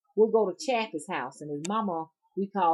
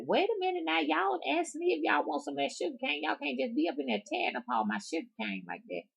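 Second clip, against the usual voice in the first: scolding granny; 10 semitones higher than usual. Retold or imitated. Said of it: "And she like".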